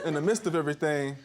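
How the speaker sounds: ordinary talk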